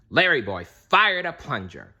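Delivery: plain reading voice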